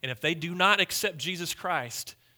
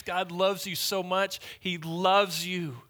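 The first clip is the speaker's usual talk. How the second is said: ordinary talk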